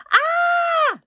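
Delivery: loud shrill scream; high scream. A narrator's reading. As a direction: shriek in terror. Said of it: "screamed Alfie".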